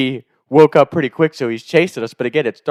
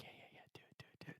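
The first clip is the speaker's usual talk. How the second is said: hushed, urgent whisper; conspiratorial whisper; 43 dB quieter, much faster than usual. Retold or imitated. Accomplice urging the prank in the dark, excited whisper. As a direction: egg him on under your breath.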